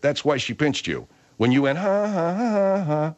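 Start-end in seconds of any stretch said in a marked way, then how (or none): 1.8-3.1 high singsong fake ha-ha; imitated mocking laugh. Explaining to Bud why she pinched, mocking imitation. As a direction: mimic someone mocking her. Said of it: "You were mocking Margaret".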